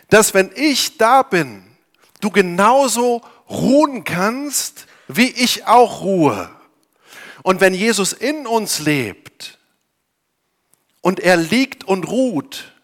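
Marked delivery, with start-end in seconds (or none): 0.1-6.5 ruhig und langsam. Acted. Jesus spricht ruhig, langsam. (langsam und beruhigend)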